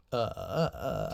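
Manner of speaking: stumbling over the start; stuttering. Real, not acted. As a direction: get stuck on uh, uh. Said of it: "I don't know why I'm stuttering".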